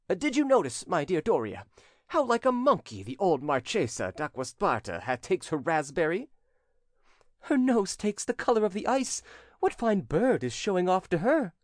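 high-pitched society chatter; gossiping young captain. A narrator's reading.

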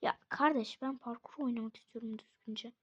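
whispered fed-up complaint; hiss-free whisper of frustration; whispering in exasperation; exasperated whisper. Real, not acted.

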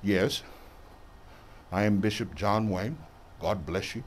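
very low, quiet official voice; official bishop. Acted. Bishop introducing himself to show fakeness, mock official. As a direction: put on a hushed, deep dignitary's greeting. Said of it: "That sound official, don't it?".

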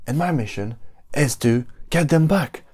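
high, hyped-up vlogger opening; YouTuber intro voice. Pretend. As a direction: sell it like a clickbait video intro. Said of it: "Another one".

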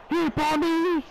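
high, urgent calls for the ball; excited shouting. Pretend. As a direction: yell for the pass.

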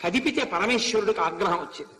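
ordinary delivery

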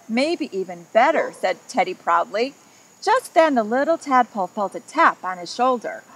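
lively read-aloud storytelling voice; story narration. A narrator's reading. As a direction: read it like a picture book to a child. Reads picture book to children.

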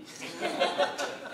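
a short, rising burst of laughter; real amused chuckle. Real, not acted. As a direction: laugh at your own joke. Joke about dreaming of Angelina Jolie.

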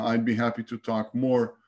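normal talking voice

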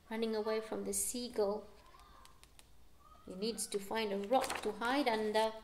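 ordinary delivery

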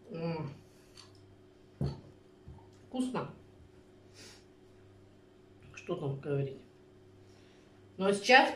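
chewing while humming; eating while speaking; mouth full of cake. Real, not acted. Seen 1: Closed mouth, cheek moving after cake bites while murmuring "m.

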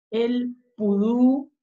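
"El pudú" slowly, each syllable held; drawn-out syllables. Shown as a demonstration. Teacher recalling who brought the letter P, slow "El pudú". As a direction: stretch "El pudú" with a held final ú. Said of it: "que nos presentó la letra P".

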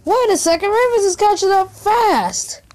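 high, alarmed urgency; being chased. Real, not acted. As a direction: panic as he gains on you. Chased by Rufus in game.

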